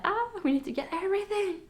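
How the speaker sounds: high, eager, a bit frantic; excited. Retold or imitated. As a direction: gush like a first-time parent. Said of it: "First pregnancy is like".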